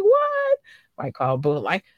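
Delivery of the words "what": very high excited squeal; inner excitement. Acted. Host acting out what goes on in her head, excited squeal. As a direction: squeak it out in amazement. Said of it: "but in my head, I'll be in here like".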